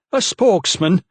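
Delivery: sneering disbelief; mocking. A narrator's reading. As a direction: belittle the upstart. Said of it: "he pointed mockingly".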